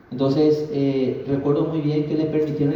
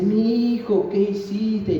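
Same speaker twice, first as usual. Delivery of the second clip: grandmother's alarm; 7 semitones higher, much slower than usual. Retold or imitated. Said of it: "Y me dice".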